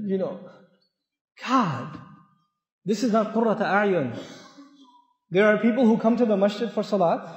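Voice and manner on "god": ordinary talk, nothing marked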